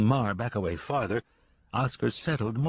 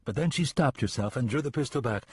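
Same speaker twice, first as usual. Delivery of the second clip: plain reading voice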